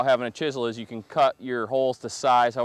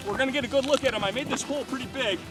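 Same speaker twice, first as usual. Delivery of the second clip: excited; 7 semitones higher, 6 dB quieter than usual. Real, not acted. Pulling up a big fish.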